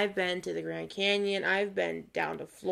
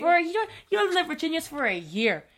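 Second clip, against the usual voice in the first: fast, raised, loud retort; mock indignation; 3 semitones higher, 6 dB louder, faster than usual. Telling off Jacob over the accent remark, mock indignant. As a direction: fire back in hammed-up outrage.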